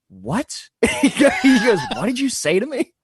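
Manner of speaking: high, sharp disbelief as the policeman; incredulous cop. Retold or imitated. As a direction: play the cop who can't believe his ears.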